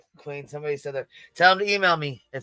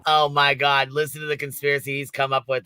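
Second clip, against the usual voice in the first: ordinary delivery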